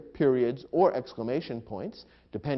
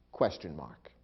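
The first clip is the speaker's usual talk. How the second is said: ordinary talk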